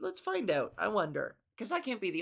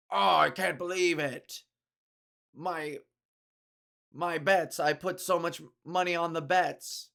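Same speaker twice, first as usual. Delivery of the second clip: louder, wooden mock despair; stilted VIP dismay; 6 dB louder than usual. Gerald mourning his lost bets, in hammed-up wooden dismay. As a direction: overact a rich man losing his bet. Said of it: "Gerald would be like".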